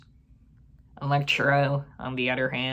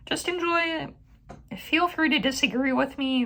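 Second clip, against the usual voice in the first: high, sing-song teasing voice; mock sweet; 13 semitones higher than usual. Reviewer teasing fans who liked the sequel, in a high mock-sweet voice. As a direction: coo it like you're humouring a child.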